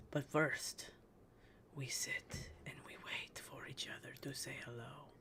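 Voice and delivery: soft unvoiced whisper. Real, not acted. Streamer waiting for chat, whispering. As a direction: hush it like a secret.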